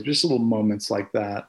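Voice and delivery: usual speaking voice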